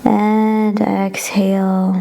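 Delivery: long drawn-out and exhale; slow exhale cue. Shown as a demonstration. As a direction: draw it out with the breath. Breath cue in yoga class.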